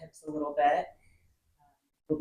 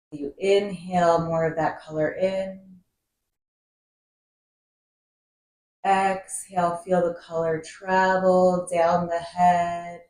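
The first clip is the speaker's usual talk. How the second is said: guided meditation. Acted. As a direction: guide the breath calmly, drawing out key words and letting phrases settle. Leads a seated breathing meditation.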